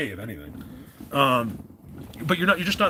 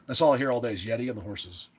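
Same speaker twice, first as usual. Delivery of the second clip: ordinary talk, nothing marked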